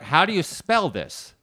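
confused brother. Retold or imitated. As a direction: ask it slowly, baffled. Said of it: "he's like".

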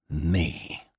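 bitter "Me", held down; terrible bitterness. A narrator's reading. Annie repeating her disbelief, with terrible bitterness. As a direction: repeat it with understated, bitter scorn. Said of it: "repeated Annie, with a terrible, bitter accent".

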